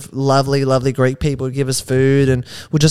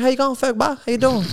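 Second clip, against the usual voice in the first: imitated Geelong drawl, pitched up and a little soft; Geelong drawl accent; 7 semitones higher, 4 dB quieter than usual. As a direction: put on a local Geelong drawl. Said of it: "like, a real drawl accent".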